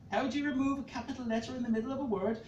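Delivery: usual speaking voice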